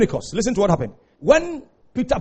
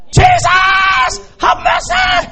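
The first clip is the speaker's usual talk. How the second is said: high shouted plea for mercy; desperate beggar's shout; 4 dB louder, much slower than usual. Retold or imitated. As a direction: cry out with all your strength. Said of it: "I will shout".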